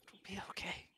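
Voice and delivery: soft reassuring whisper; comforting whisper. Acted. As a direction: whisper it close to the ear. Fey comforts the held player.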